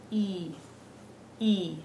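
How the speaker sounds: E as a pure "ee", repeated after a pause; Spanish I vowel. Shown as a demonstration. Teacher demonstrating the Spanish letter I, E said as "ee". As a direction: say E as the "ee" in see. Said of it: "The I is pronounced".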